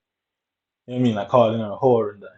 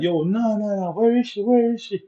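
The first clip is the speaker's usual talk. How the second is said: high mimic of friends insisting where is she; pitched-up retold demand, pressing him; his friends demanding to know where she went, mimicked high; imitating demanding mates; 9 semitones higher than usual.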